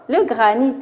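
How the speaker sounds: granit ending in an audible t; final T pronounced. Shown as a demonstration. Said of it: "On prononce le T". (granit avec le T final prononcé)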